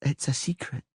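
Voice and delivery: put-on high girlish voice, stubborn and petulant; a spoiled little princess making her case; young girl Ciri. A little princess arguing with the Witcher, high girlish voice.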